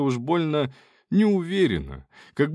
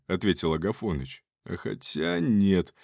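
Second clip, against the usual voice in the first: plain reading voice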